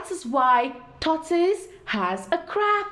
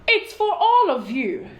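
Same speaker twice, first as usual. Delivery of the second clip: host's answer; 5 semitones higher, 5 dB louder than usual. A narrator's reading. Hosts answer tortoise.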